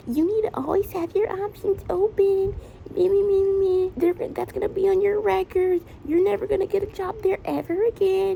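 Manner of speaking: lightly mimicked nagging mother; mom lecturing. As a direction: imitate mom lecturing her kid. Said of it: "sitting there lecturing me".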